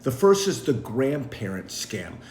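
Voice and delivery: usual speaking voice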